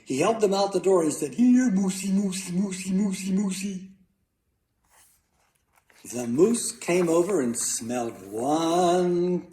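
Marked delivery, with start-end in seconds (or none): 1.4-3.9 high, repetitive animal-calling singsong; coaxing call. A narrator's reading. Boy luring moose with carrots, high coaxing call.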